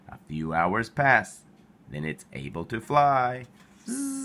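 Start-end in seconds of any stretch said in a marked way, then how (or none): 3.9-4.2 high, soft buzz of wings; a light high insect buzz; buzzing zzz. Acted. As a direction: buzz like a flying bug. Ladybug flies away.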